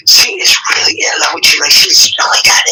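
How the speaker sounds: whisper. Retold or imitated. Friend gushing secret to teacher.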